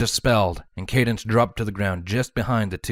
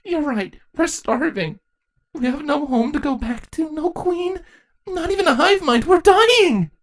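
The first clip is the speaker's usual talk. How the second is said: high, rising to a loud cry; despairing teenage changeling; 4 dB louder than usual. Acted. Saronis tells the ponies they are dying, building to a cry. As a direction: build from sorrow to anguish.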